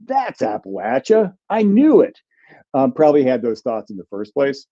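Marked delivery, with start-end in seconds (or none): 0.0-2.2 smug viewers. Retold or imitated. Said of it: "and go".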